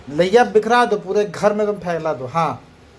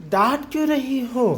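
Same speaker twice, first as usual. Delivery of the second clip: put-on child's voice; 6 semitones higher than usual. Voicing child's complaint.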